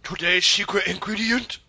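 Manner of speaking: high, slow, theatrical announcer reveal; hammed-up game-show host announcement; Iron Chef host. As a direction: draw it out like a grand TV reveal. Imitates Iron Chef host's reveal.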